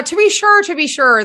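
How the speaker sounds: high, loud mock Irish lilt; hammed-up stock Irish accent; put-on Irish accent. Shown as a demonstration. Host warming up into her Irish accent, playful mock Irish. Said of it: "That's how I get into my Irish".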